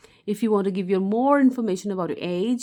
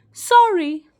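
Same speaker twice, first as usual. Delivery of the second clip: emphatic apology; 9 semitones higher, 9 dB louder than usual. Shown as a demonstration. Teacher demos an apology.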